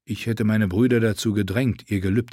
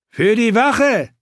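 very high, loud, drawn-out shout; battle cry; 12 semitones higher, 6 dB louder, slower than usual. A narrator's reading. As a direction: cry it out as you attack. Attacker cries out while stabbing. (lauter, gedehnter Kampfschrei)

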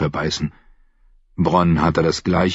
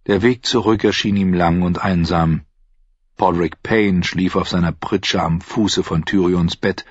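usual speaking voice

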